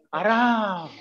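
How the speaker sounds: drawn-out, falling mimicked answer; mock-idle, lingering delivery; lazy reply. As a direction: stretch it out like someone taking it easy. Mimics people's lazy stock answer.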